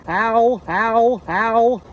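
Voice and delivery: sharp yelps of pain; real pain, short high cries; pained ows. Real, not acted. Scraped shin on trail.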